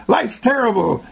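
high-pitched mock despairing gripe; mock complaint. What you are saying when mad, mock complaint. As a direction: voice it as an exaggerated grievance. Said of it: "You are saying".

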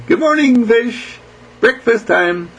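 high, singsong hello to pets; playful high-pitched pet talk; greeting pet fish. Retold or imitated. Greets his goldfish at feeding.

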